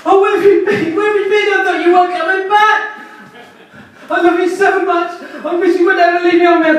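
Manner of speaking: squeaky, high, shouting overjoyed dog; excited dog. Pretend. Dog greeting its owner home from work with frantic joy. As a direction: gush like a dog that missed you all day.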